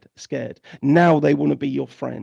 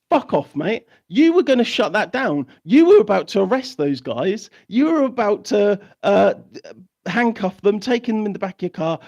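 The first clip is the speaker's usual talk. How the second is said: angry rant; 5 semitones higher, 4 dB louder than usual. Real, not acted. Ranting at police directly.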